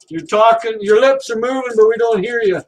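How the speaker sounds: usual speaking voice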